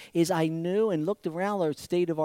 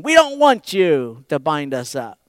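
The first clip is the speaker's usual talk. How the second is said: tag; 9 dB louder than usual. Acted. Preacher voices the protesting people.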